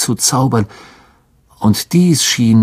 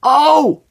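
high sharp ouch; yelp of pain. A narrator's reading. Lockhart kicked in the shin: sharp pained yelp. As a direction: cry out when kicked. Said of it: "ein lautes".

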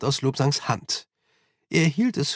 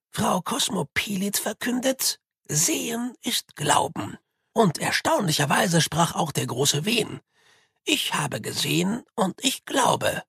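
a hint of a higher, thin old monk; lightly voiced serene old monk, unhurried; leicht angedeuteter alter Mönch; 6 semitones higher than usual. A narrator's reading.

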